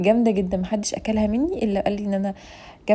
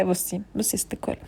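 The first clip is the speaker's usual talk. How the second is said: soft whispered address; 3 semitones lower, 10 dB quieter than usual. Real, not acted. Addressing viewer intimately.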